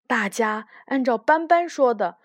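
plain reading voice

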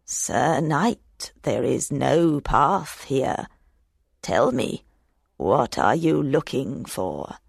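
put-on low, slow old hag voice. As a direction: creak it out like an old crone. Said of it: "This ugly hag stood up and said".

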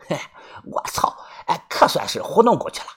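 sly chuckle, then hushed relief; lightly amused, keeping it down; smiling relief. Acted. Grandson relieved at fooling his grandfather, smiling relief. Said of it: "笑着说".

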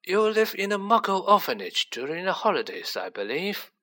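put-on high-pitched old headmaster, mildly curious. A narrator's reading. Headmaster questioning a pupil about his home, curiously. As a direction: ask with a touch of curiosity. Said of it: "said Tippett curiously".

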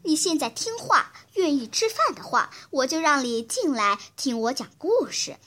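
usual speaking voice

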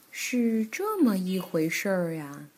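low, lightly aged granny voice; Granny Bear. Acted. Granny Bear hears the children's story, old granny voice. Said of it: "熊婆婆说".

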